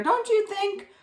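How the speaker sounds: usual speaking voice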